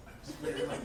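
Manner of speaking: ordinary delivery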